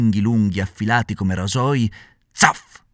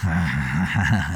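mocking snicker; 6 semitones lower than usual. A narrator's reading. Assassins jeering at the hanged puppet, low snicker. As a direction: sneer a little laugh. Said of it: "gli dissero sghignazzando".

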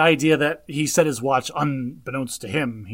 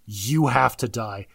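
dead serious; 5 semitones lower, slower than usual. Retold or imitated. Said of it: "dead serious".

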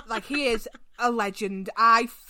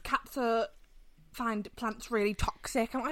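ordinary delivery

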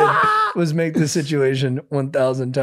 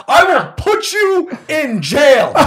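much higher, much louder, slow shout breaking into laughter; threatening shout; 10 dB louder, much slower than usual. Retold or imitated. Fake lawyer threatening her with jail, shouting through laughter. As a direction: yell the threat while cracking up. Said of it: "I start making threats".

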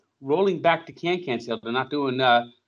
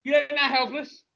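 very high, fast dismissive voice; snotty manager; 13 semitones higher, 3 dB louder than usual. Pretend. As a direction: talk down to the customer, high and quick. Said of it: "the manager says".

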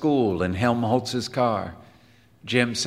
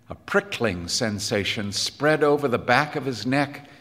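ordinary delivery, nothing marked

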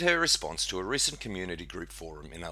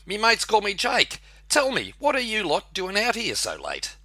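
high, chirpy Aussie bloke; Aussie snake voice; 14 semitones higher, 5 dB louder than usual. Acted. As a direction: play the cheeky snake up high. Snake Jake introduces himself.

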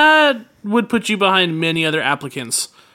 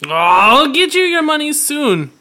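nervous placating; 7 semitones higher, 3 dB louder than usual. Acted. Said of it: "Labet groans".

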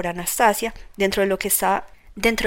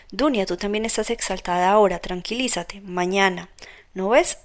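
plain reading voice

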